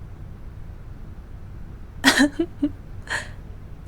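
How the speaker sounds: breathy airy laugh under the breath; the queen laughs softly at her knight. Acted. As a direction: let out a quiet amused laugh.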